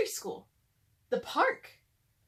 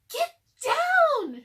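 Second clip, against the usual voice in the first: very high, loud warning shout; urgent shout; 10 dB louder than usual. Acted. Annie shouts a warning to Jack in the wind. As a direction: yell at him to duck. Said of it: "shouted Annie".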